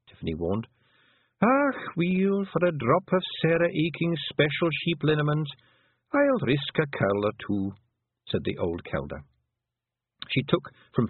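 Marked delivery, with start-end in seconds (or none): 1.4-7.7 put-on higher Scottish old-woman voice, slow and proud; an old clan mother talking fondly of her lads; old Pictsie mother. Old Kelder tells Tiffany about her many sons, higher Scots burr.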